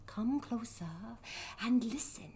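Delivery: whisper. Pretend. Spooky storyteller beckoning.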